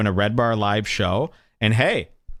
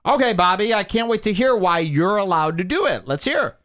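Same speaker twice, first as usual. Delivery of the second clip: high-pitched sarcastic astonishment; scoffing, exaggerated surprise; mock incredulity; 7 semitones higher than usual. Real, not acted. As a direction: goad them to explain themselves. Mocking reply to played clip.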